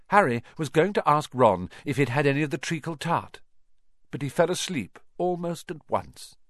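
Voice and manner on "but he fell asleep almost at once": ordinary delivery, nothing marked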